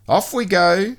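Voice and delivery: high, cheery and loud; Mister Tidy voice. A narrator's reading. Mister Tidy sets off with Messy, high and cheery. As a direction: off on an outing.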